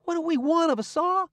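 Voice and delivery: high, loud incredulous echo; scornful echo. A narrator's reading. Tom echoing Huck in loud disbelief. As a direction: throw the question back, amazed.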